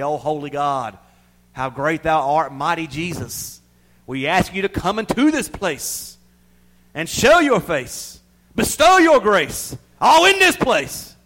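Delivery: slow, loud, rising mock prayer; pompous showy prayer. Preacher mocking a fancy showy prayer, hammed-up and rising. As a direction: ham up a grand churchy prayer. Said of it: "It don't matter how we try to pray".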